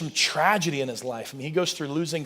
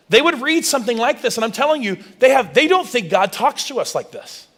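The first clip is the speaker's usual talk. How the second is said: ordinary delivery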